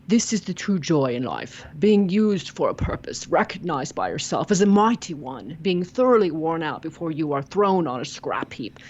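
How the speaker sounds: reading the quote in an attempted English accent. Guest reading the Shaw quote to the host, English accent. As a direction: do your best English accent for a grand quote. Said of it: "I'm gonna do my best to do an English one".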